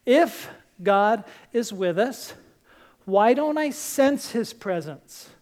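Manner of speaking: slow, each phrase weighed; a slow deliberate reading of the focus question; slow, emphatic. Shown as a demonstration. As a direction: read it slowly and let it sink in. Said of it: "That's what this whole series has been about".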